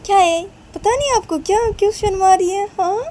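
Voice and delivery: high, loud daughterly protest; hammed-up irritation; exasperated retort. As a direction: cut mom off, high and loud. Daughter objects to mother.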